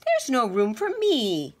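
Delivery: a fed-up mouse with no bed; indignant mouse; annoyed mouse. A narrator's reading. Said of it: "squeaked Mortimer".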